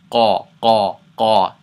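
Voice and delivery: Qa from the back of the throat; heavy Arabic Qaf sound in Qa; deep back-of-mouth Qa. Shown as a demonstration. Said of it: "it should be in the back of the mouth".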